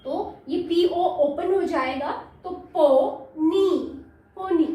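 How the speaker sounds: पो and नी apart, then पोनी; syllable split. Shown as a demonstration. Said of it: "syllable division रहेगा".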